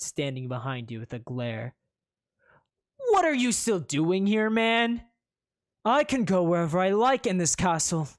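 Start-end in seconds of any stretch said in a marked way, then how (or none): 3.1-5.1 huffy rival. Acted. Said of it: "he huffs". 5.8-8.1 haughty huff, pitched up; a proud young prince huffing indignantly. Acted. Rival prince huffs at the player, raised haughty voice. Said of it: "he huffs".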